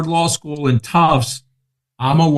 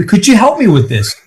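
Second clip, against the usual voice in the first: higher, louder, through a laugh; laughing mock request; 7 semitones higher, 5 dB louder than usual. Retelling the partner's faucet request, laughing and higher. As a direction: ask it laughing, mocking the partner. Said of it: "He said".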